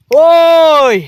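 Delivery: loud, long-held call; challenging shout. Acted. Hero confronts rival over marrying Nitu, shouting. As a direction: holler it at your rival.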